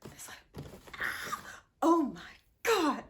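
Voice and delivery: gasping, near-crying shock; breathless "oh my God" in disbelief. Real, not acted. Shocked reaction to Killmonger.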